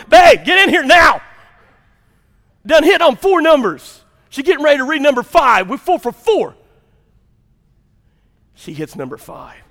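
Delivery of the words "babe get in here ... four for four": high, very loud holler to a spouse; excited yelling. Acted. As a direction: yell the lottery news across the house. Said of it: "you're yelling".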